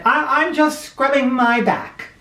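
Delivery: high, hasty excuse through the bathroom door; fibbing husband. Acted. Husband answering his wife from the tub, high fib. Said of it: "he said".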